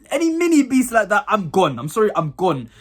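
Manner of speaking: normal talking voice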